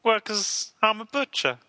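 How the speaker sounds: high frog puppet voice; Kermit the Frog. Acted.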